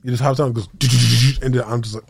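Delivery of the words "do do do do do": gunfire noise. Retold or imitated. Imitating machine gun fire.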